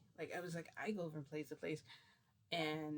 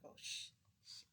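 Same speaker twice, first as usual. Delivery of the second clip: whispered hush; scared whisper; 8 dB quieter than usual. Retold or imitated. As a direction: whisper to keep the vampire quiet. Hushing in fear.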